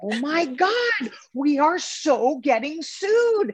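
mock-horrified panic, pitched very high. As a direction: gasp it out, horrified and half laughing. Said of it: "And I'm like".

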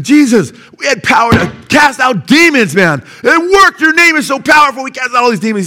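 high, loud, thrilled shouting of good news; excited disciples. Acted. Disciples back to Jesus, shouting excitedly. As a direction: burst in bragging about the power. Disciples report casting out demons.

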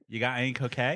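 put-on Australian accent. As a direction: imitate a friend's one line of Australian. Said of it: "to say in Australian is".

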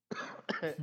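a single rough cough, unvoiced; chesty cough. Real, not acted. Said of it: "Scusate la tosse".

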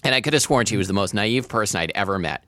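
ordinary delivery, nothing marked

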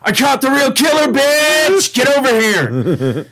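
high, hammed-up call to the others. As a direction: shout to summon the gang, drawing out the end. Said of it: "Rick calls the other Ricks".

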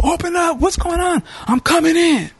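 high, put-on voice of the maintenance guy; mock high-voiced maintenance man demanding entry; maintenance man yelling. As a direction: pitch way up as the guy at the door. Maintenance man bursting in, retold.

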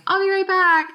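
high, sustained sing-song call; airy excuse. Retold or imitated. Telling the field director she's popping out, high sing-song excuse.

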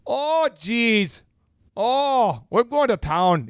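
long, lifted Minnesotan "oh"; Minnesotan accent. Acted. Minnesotan combining stock phrases, mock accent. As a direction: draw out each "oh" in mock Minnesotan. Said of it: "combinations over time where you might say".

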